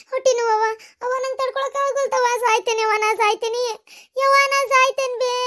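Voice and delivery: high, anguished labour cries; pain-racked pleading, pitched high; wailing in pain. Acted. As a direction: wail desperately to mother. Daughter wails through labour pains.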